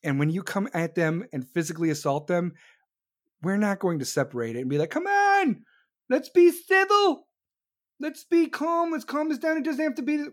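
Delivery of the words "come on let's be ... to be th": mock pleading. People urging calm at a fight, mocking high plea. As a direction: plead like a whiny peacemaker, much higher.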